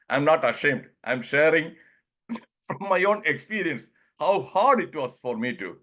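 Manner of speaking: usual speaking voice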